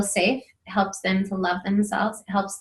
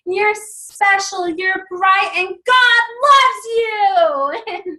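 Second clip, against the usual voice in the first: high, slow, loud sung-out praise; sing-shout the blessing; love fight shout; 11 semitones higher, 6 dB louder, much slower than usual. Retold or imitated. Mom hollering affirmations back in love fight. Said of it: "and I'm like".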